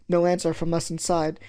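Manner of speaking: usual speaking voice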